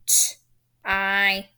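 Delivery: T-I-L as t, long "eye", ul, with gaps; three separate sounds. Shown as a demonstration. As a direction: sound out T-I-L one sound at a time. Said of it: "It's only three sounds".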